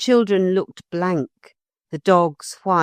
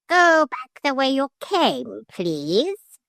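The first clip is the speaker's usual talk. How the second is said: higher, slow coaching of the line; parrot demonstrating; 9 semitones higher, slower than usual. Shown as a demonstration. Parrot showing the lady what to say. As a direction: model the words for her. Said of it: "I should just say".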